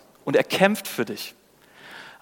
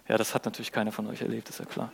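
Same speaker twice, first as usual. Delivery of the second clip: much softer, lower and quicker; wry aside; 4 semitones lower, 11 dB quieter, faster than usual. Real, not acted. Preacher to congregation about exam nerves, wry quiet aside. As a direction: toss it off quietly, tongue in cheek. Ironic aside to former students.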